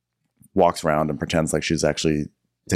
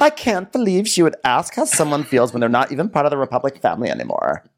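high prissy mock outrage, dropping at end; 6 semitones higher, faster than usual. Cohost voicing T J scolding Leva, high mock outrage. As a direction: sniff it like an offended club insider.

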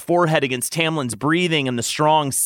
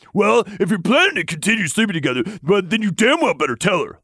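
growling; 7 semitones higher, faster than usual. Acted. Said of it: "Lucien growled".

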